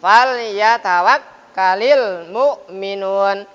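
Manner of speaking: fal yatawakkalil mu'minun slow and even; correct idhar recitation. Shown as a demonstration. Teacher giving the right recitation after the wrong one. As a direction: recite it slowly and clearly, no pressure.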